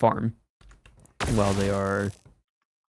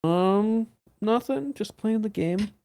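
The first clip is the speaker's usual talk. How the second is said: innocent high voice; 11 semitones higher, slower than usual. Acted. Innocent reply to accusation.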